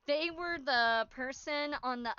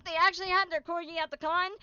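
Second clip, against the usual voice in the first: ordinary talk, nothing marked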